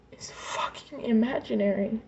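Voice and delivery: a real, tearful whisper; words barely breathed out through tears; broken whisper. Real, not acted. Telling her mother she's imaginary, in a crying whisper.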